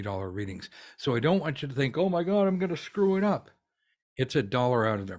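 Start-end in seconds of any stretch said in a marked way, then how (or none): 2.0-3.5 high, hammed-up fretting; mock panicked squeal of self-doubt. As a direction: play the nervous beginner, a little over the top. Mock anxious student thought.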